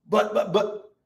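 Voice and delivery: stammered attempt to cut in; trying to interject. Job trying to answer his accuser, stammered interjection. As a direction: start and restart as if interrupted. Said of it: "Job's trying to say".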